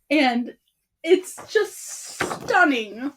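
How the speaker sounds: ordinary talk, nothing marked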